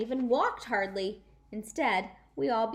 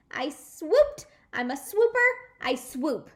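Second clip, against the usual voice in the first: gleeful; 6 semitones higher, 9 dB louder than usual. Acted. May gloating, jumping.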